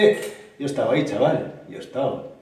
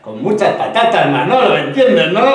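loud, raised, gleeful emphasis; excited innuendo; 5 semitones higher, 10 dB louder than usual. Acted. Nudging Manolo about the sirloin, loud excited innuendo. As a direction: push it loud, about to burst out laughing.

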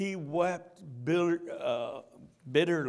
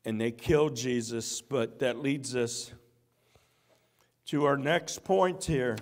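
normal talking voice

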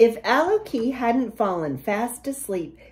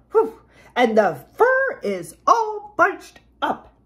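higher, put-out mama bear; huffing mama bear; 6 semitones higher, 4 dB louder than usual. A narrator's reading. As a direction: huff it as an offended mama bear. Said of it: "she huffed".

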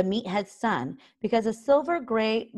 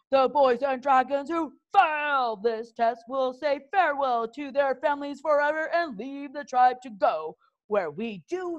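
much higher, menacing instructor voice; grim threat; 8 semitones higher than usual. A narrator's reading. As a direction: warn them through bared teeth. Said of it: "bared his teeth grimly".

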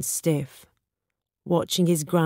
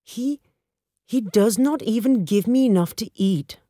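higher, indignant complaint; voice breaking with anger; 6 semitones higher, 3 dB louder than usual. A narrator's reading. Old father complaining to his friend about his son, angry. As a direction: protest bitterly, pitch jumping up. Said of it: "his voice breaking with anger".

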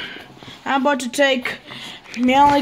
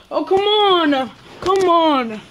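higher, drawn-out pretend pleading; playful coaxing; 4 semitones higher, much slower than usual. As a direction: stretch it out, coaxing a reluctant pet. Coaxing a frightened cat in play.

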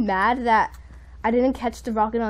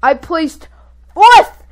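elated shout; 4 semitones higher, 12 dB louder, much slower than usual. Real, not acted. Player celebrating best placing ever, elated shout. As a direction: build to a high, loud FOURTH.

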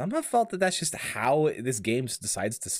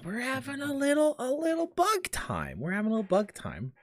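high, lilting sing-song; playful sing-song; 11 semitones higher than usual. Real, not acted. Streamer teasing chat about bug game, sing-song. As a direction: croon it playfully, higher.

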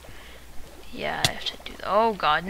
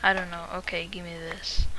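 ordinary delivery

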